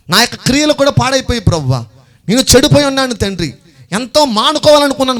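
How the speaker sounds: high, loud plea to the Father; impassioned prayer of confession. Acted. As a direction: cry out a confession to God. Models a sinner's confession to God. (ఆవేశంతో దేవునికి మొర)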